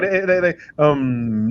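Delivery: ordinary talk, nothing marked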